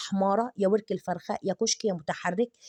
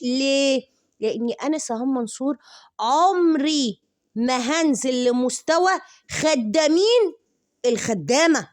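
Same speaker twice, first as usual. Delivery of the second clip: loud, high, slow put-down; haughty mock; 4 semitones higher, 6 dB louder, slower than usual. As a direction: look down on them, louder and higher. Scornful mock of a rival. (تعالٍ بصوت عالي ومرتفع وبطيء)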